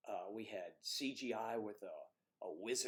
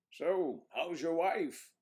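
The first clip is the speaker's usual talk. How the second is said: acted movie line; 6 semitones higher, 7 dB louder, much slower than usual. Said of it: "And I say to this guy".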